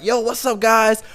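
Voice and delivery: high-pitched, tipsy hello; upbeat greeting. Retold or imitated. Acts out drunk second-wind greeting.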